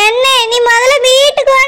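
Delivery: usual speaking voice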